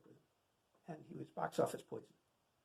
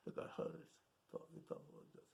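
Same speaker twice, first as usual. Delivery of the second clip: mumbling Brando impression; 10 dB quieter than usual. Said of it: "he took some tissue paper, put it under his lower lip".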